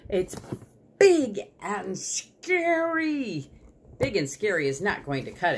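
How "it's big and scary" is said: high, very slow squirrel wail; frightened squirrel. Acted. Squirrel repeating the danger to Sugar, frightened. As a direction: stretch each word out in high-pitched alarm. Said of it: "said the squirrel".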